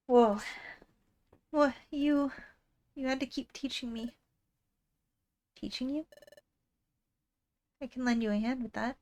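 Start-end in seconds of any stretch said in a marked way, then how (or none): none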